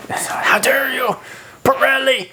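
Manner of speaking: high-pitched, drawn-out mock indignation of an Italian fan; outraged Italian fan. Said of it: "one Italian fan somewhere".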